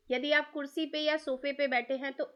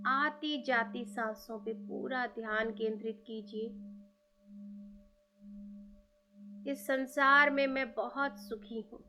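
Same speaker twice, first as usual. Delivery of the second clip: guided meditation; 2 semitones lower than usual. Acted. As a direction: lead listeners gently inward. Guided meditation invoking gurus.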